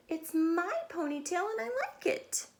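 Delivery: put-on high, firm little girl; lightly voiced Stephanie. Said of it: "Stephanie said".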